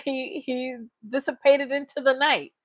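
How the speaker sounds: usual speaking voice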